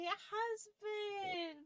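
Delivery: choked up. Real, not acted. Emotional thanks to viewers.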